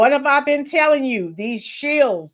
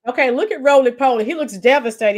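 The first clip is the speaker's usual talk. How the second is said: ordinary delivery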